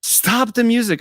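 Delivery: high-pitched mock shout to halt everything; drawn, high yell at moderate volume; imitated yelling. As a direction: imitate someone calling out down a hall. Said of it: "there's somebody's yelling in the hall".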